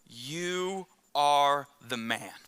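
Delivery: slow, low, weighty verdict; a prophet pronouncing judgment, slow and grave; solemn accusation. Acted. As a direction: let each word land on the king. Nathan confronts king David.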